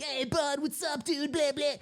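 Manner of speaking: high, exuberant mock greeting; happy drunk buddy. Tipsy friend coming over to say hi, mockingly overjoyed. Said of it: "Like, he's all happy".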